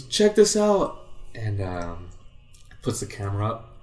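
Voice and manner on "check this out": high, loud eager call; retold excited shout. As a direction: pitch it up with excitement. Friend showing video.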